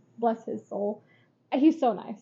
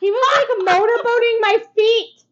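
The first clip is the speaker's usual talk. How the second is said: incredulous outburst; 10 semitones higher, 9 dB louder than usual. Real, not acted. Telling shocking foot story.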